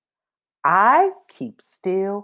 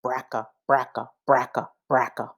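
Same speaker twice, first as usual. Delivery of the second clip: fast, rattling bracka bracka; rattling old car; 6 semitones lower, 4 dB quieter, faster than usual. Shown as a demonstration. Reading aloud, rattling like a noisy old car. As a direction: chug it out like a clattering old engine. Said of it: "Old cars sound like this".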